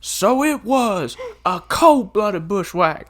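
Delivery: high, slow laughing put-on voice; Rooster impression. Quoting Rooster's courtroom line to a friend, high and slow. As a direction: drawl it out while laughing.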